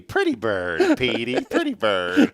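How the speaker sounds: high, slow, drawn-out pet-bird cooing; movie scene imitation. Host quoting a Dumb and Dumber scene, high slow imitation. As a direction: stretch it out high and loud, playfully mimicking. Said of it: "that scene in Dumb and Dumber".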